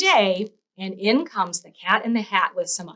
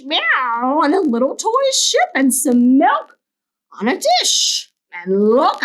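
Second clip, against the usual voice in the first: high, sing-song boasting cat; showing-off cat; 6 semitones higher than usual. Acted. Cat in the Hat showing off to the kids. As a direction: crow about every trick. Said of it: "said the cat".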